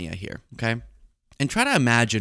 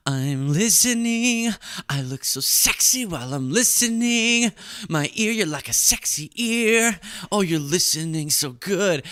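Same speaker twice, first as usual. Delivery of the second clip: hammed-up narcissistic actress, pitched way up; self-obsessed inner voice; 8 semitones higher than usual. Vain actress thinking to herself while pretending to listen, high self-obsessed voice. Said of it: "start hearing her inner thoughts. And she's like".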